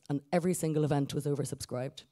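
usual speaking voice

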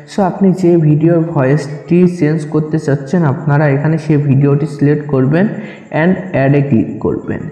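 normal talking voice

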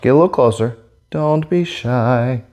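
ordinary talk

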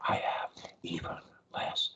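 whisper. Shown as a demonstration. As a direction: drop right down to a whisper. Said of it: "If I whisper".

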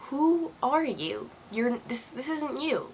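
hushed, halting words to an unrecognizable reflection; stunned disbelief. Retold or imitated. Retelling speaking to her swollen reflection.

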